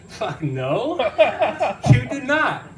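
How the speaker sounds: high, laughing you-did-not; laughing disbelief. Retold or imitated. Son to dad about the dead body, laughing disbelief.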